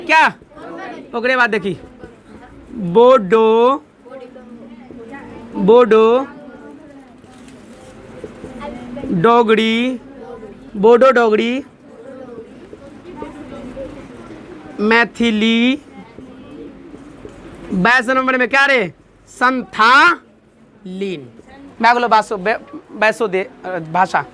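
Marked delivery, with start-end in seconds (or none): 2.7-15.8 drawn-out syllables with long gaps between names; slow dictation. Shown as a demonstration. Teacher dictating language names to the class, slowly drawn out. 19.3-21.3 very drawn-out, broken into syllables; slow dictation. Shown as a demonstration. Teacher dictating the last language to the class, drawn out.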